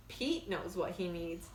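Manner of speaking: ordinary delivery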